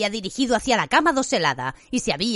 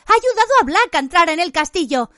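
higher and louder, slowing at the end; frantic warning shout; 8 semitones higher, 3 dB louder than usual. A narrator's reading. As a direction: warn him desperately. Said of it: "gritó Hermione".

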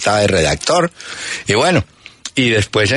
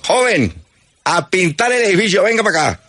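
mock sergeant; 9 semitones higher than usual.

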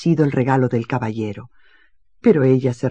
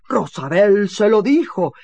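higher, louder indignant protest; 6 semitones higher, 5 dB louder than usual. Acted. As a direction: accuse the maid. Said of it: "protestó el caballero". (protesta indignada)

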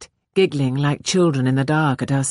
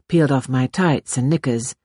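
usual speaking voice

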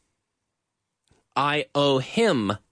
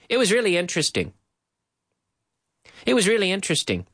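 quick native pace, words run together; fast, connected, natural speech; fast linked delivery; 3 semitones higher, faster than usual. Shown as a demonstration. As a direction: rattle it off like a native speaker. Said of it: "fluent model at speed".

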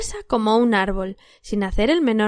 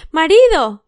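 loud, high call to wake him; sharp wake-up call; waking the husband; 6 semitones higher, 8 dB louder, slower than usual. A narrator's reading. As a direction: jab him awake with his name. Wife elbows sleeping husband.

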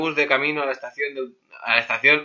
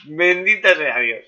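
slow, laughing mock blessing; drawn-out amused exclamation; mock pious; 4 semitones higher, 3 dB louder, much slower than usual.

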